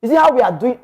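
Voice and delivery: normal talking voice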